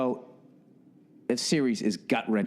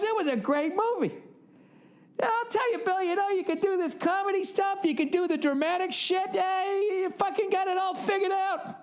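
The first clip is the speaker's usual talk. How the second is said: much higher, put-on voice of an excited old dad; dad impression. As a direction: play your father telling the story on the phone. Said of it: "He goes".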